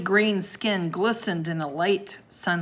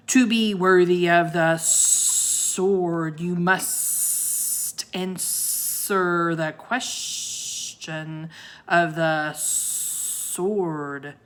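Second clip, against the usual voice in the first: very slow, drawn-out monster voice; booming ancient voice; much slower than usual. A narrator's reading. Sea serpent sets the test, slow booming monster. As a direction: stretch each word like an ancient beast. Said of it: "the ancient voice boomed".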